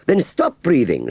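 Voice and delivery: high, loud, slow lofty command; triumphant order. A narrator's reading. Aunt Sally triumphantly ordering Wurzel, high slow command. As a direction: order him about, pleased with yourself. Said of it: "she ordered triumphantly".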